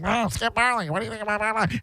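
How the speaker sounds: high, garbled self-parody; mock radio intermediary. Host parodies himself interviewing [name], high mocking babble. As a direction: send yourself up as a babbling go-between. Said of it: "to then me as an intermediary".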